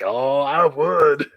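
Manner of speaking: slow, loud threatening drawl; slow menace. Retold or imitated. Jubilee to the Collector, slow and menacing. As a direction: savour the threat. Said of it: "she's like".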